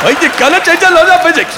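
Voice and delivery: high, loud, garbled mock Scottish accent; badly mangle a Scottish accent in a panic; Scotty. Mocking Star Trek's engineer, frantic garbled Scottish accent. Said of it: "Scotty in Star Trek".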